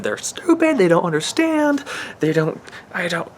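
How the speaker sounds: high, loud flare of anger at other people; real irritation, voice leaping up and loud; angry outburst. Real, not acted. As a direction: spit out "they're stupid" in sudden frustration. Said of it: "ah, I get so angry".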